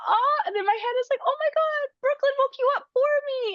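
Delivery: very high, drawn-in-the-head "oh my God" voice; excited inner exclamation. Retold or imitated. Mother silently reacting to her son's news, excited inner exclamation. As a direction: squeal it inwardly, retelling your amazement. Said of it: "in my head I was saying all this".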